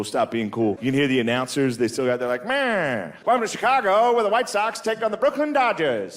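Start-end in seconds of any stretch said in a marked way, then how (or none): 2.5-6.2 high-pitched vintage ballgame announcer; old-time radio announcer. Acted. Announcer welcoming listeners to the game, old-timey. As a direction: open the broadcast in an old radio voice. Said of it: "he knocked that voice out of all the whites".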